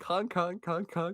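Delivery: high mock horn honks; clown horn honk. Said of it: "He's like".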